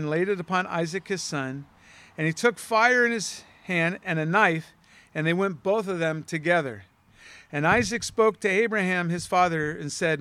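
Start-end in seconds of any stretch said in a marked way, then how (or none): none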